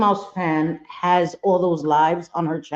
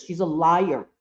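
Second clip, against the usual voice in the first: ordinary delivery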